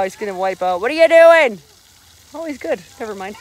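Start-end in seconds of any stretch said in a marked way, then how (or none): 0.8-1.6 loud high call, falling at the end. Real, not acted. Mom calling to her son again, loud call. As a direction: shout the question across the water.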